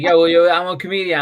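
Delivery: hammed-up show-off announcing himself; mock boast. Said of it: "I was like".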